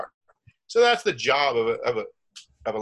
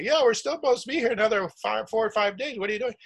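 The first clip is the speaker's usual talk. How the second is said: mock tantrum; 8 semitones higher than usual. Girlfriend objecting to being left on the dock, mock tantrum. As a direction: play a hammed-up protest in a much higher voice. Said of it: "throw a tantrum and say".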